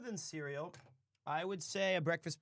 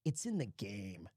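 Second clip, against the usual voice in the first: announcer imitation; 9 semitones lower than usual. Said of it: "It's E A Sports".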